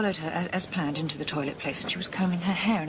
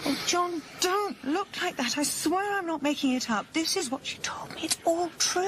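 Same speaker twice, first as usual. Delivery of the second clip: high-pitched, urgent appeal; earnest pleading; 8 semitones higher than usual. Acted. Wife insisting to doubting husband, pleading. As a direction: plead with him to believe you.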